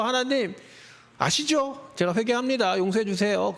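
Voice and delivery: preacher mimics a repeat sinner begging forgiveness, wheedling; mock wheedling prayer. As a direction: ham up the cheap repentance.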